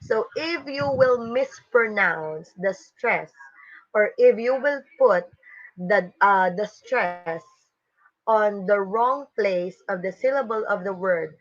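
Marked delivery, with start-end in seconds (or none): none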